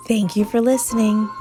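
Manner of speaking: ordinary delivery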